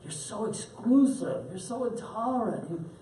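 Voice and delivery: high-pitched, teasing pagan cajoling monotheists; mocking Greek pagan. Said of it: "the Greeks want to force them".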